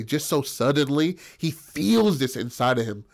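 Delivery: ordinary talk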